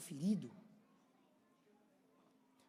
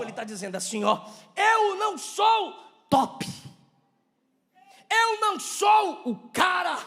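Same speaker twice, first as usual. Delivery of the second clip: high, shouted sermon at full force; fiery preaching; 11 semitones higher, 18 dB louder than usual. Real, not acted. Preacher humbling the church with Paul's confession, impassioned shouting. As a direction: cry it out to the congregation in long, ringing phrases.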